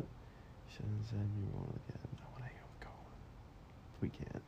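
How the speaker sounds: whispering the plan. Real, not acted. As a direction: hush it like a secret. Secret plan, muffled low.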